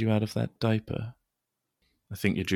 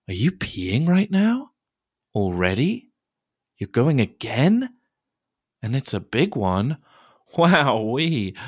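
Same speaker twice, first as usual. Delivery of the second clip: higher, louder mock astonishment; surprised; 5 semitones higher, 5 dB louder than usual. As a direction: exclaim in playful surprise. Surprised exclamations.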